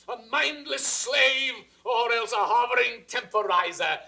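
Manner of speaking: normal talking voice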